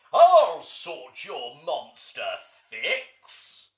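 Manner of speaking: very loud, slow booming monster voice; big bad monster. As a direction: boom it out like a giant beast. Huge monster announces itself.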